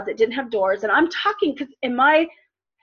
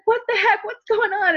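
alarmed questions; 9 semitones higher than usual. Retold or imitated. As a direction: demand to know what happened, rattled. Mom alarmed after turning light on.

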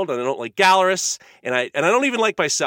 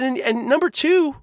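angry retort; 9 semitones higher, 4 dB louder than usual. Retold or imitated. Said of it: "I said".

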